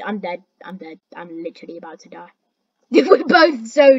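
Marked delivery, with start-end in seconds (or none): 0.0-2.3 resigned mutter. Real, not acted. Resigned to dying.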